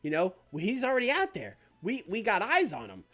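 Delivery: smooth-talking Stokely. Acted. Stokely reassures Zack he's in his corner, high slick pitch. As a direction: sell him the deal like a slick manager. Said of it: "He's gonna be like".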